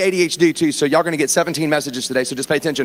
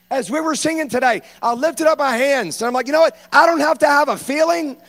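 high, fervent revival preaching; full-force preaching; 8 semitones higher than usual. Acted. Pastor preaching to the congregation about heaven's worship, fervent and high. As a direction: lift the crowd like a revival preacher.

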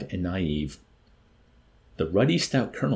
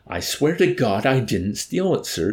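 slow, loud, higher-pitched plea; distressed pleading; 6 semitones higher, 5 dB louder than usual. A narrator's reading. Chaplain to his accusers, pleading almost in tears. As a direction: plead desperately, leaning on each word. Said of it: "the chaplain pleaded with distress, almost in tears".

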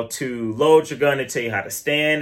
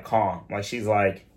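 normal talking voice

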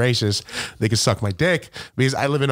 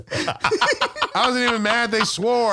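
slow words through real laughter; giggling mid-sentence; laughing; 12 semitones higher, much slower than usual. Real, not acted. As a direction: laugh it out as you talk. Laughs while telling story.

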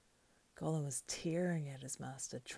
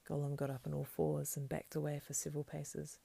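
usual speaking voice

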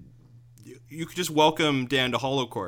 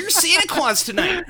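mock trailer line; 5 dB louder than usual. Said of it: "trailer quote mocked".

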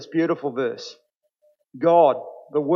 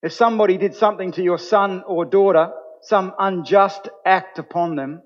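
preaching; 3 semitones higher, 4 dB louder than usual. Acted. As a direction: build the message with rising conviction. Preacher builds to full force.

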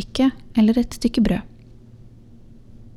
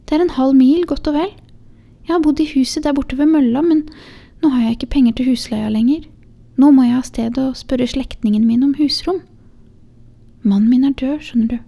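higher voice, a poor widow explaining her need; weary young mother; 5 semitones higher, 4 dB louder than usual. Acted. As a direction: plead gently as a tired young mother. Mother voiced in read story. (ung, sliten mor med lysere stemme)

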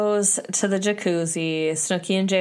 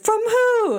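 very high mock roar; Pauly roaring; 4 dB louder than usual. Demanding J Wow's source, hammed-up angry roar. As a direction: fire it out as an imitated outraged demand. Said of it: "the roar that Pauly gets to".

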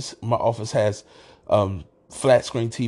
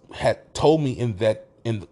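ordinary talk

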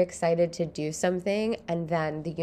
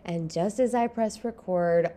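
ordinary talk

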